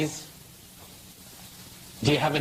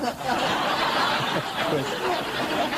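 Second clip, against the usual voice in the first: a high, unvoiced burst of laughter; high breathy laugh; 11 semitones higher than usual. Real, not acted. Speaker laughing at his own Republican joke, high and breathy. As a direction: let a high, airy laugh out.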